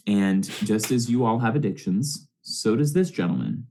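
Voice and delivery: usual speaking voice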